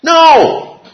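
a high, loud, ringing "No!"; emphatic shout. Real, not acted. Teacher to class about heaven, a high loud No. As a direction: shout it out, full of delight. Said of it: "emphatic answer to the class".

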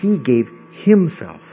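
slowly spaced, with "himself" standing on its own; emphasis on HIMSELF. Shown as a demonstration. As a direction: set "himself" apart and give it weight. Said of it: "puts the emphasis on himself".

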